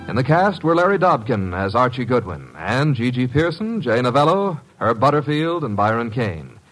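ordinary talk

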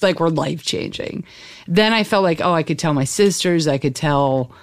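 normal talking voice

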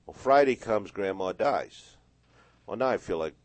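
ordinary delivery

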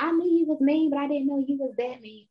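a hint of a high, shocked friend; mock gasp at how mean she is; friend's shocked voice. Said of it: "And she's like".

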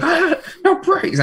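high, slow, laughing mock rage; imitating angry driver. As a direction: ham up a furious driver. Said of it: "He was livid".

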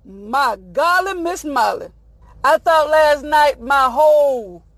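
ordinary delivery

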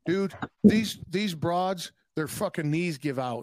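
ordinary talk, nothing marked

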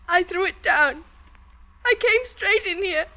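crying through the words; tearful confession. Acted. Daughter confessing the killing through tears. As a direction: choke it out through sobs.

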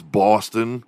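usual speaking voice

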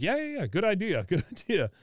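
high, eager mock agreement; imitating someone suddenly all for it. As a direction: jump on the offer, pitched well up. Retelling Min suddenly jumping at money.

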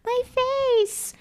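very high, soft whimpering wail; mock wail. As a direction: cry it like a hurt cartoon villain. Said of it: "And he's like".

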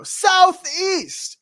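very high, loud callout; game-text announcement. Acted. Game highlights the direction in blue, high loud callout. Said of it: "it says in blue text".

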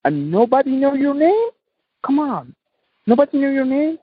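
high, rising disbelief; passionate challenge. Real, not acted. Urging listeners to leave a legacy, high and incredulous. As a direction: demand it of them, incredulous.

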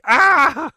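high, loud cartoon scream; mock scream. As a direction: shriek like being eaten by ants. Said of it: "just screaming".

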